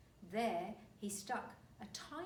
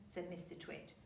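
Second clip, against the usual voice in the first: plain reading voice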